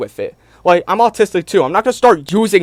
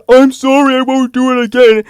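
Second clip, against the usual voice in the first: mock apology; 7 semitones higher, 4 dB louder than usual. Mocking Ethan's fake apology.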